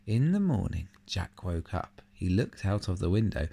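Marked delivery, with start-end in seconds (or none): none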